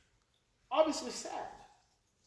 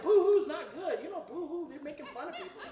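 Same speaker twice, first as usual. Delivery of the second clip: mocking others; 9 semitones higher, 5 dB louder than usual. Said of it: "people are like".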